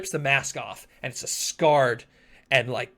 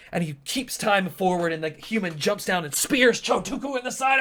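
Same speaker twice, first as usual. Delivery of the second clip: higher, rising shout of action; excited narration; 6 semitones higher, 3 dB louder than usual. A narrator's reading. Game master narrates human spearing Chotuku, excited shout. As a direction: build the strike to a yell.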